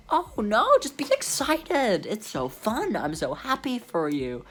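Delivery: high, sing-song cheer to calm a patient; chirpy assistant. Retold or imitated. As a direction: do the sweet, upbeat nurse on the phone. Imitating the cheerful doctor's assistant.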